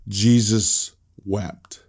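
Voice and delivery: "Jesus wept" drawn out very slowly; slow, solemn. Shown as a demonstration. Pastor repeats the shortest verse slowly and solemnly. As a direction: let each word of "Jesus wept" hang. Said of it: "this is the shortest verse in the Bible".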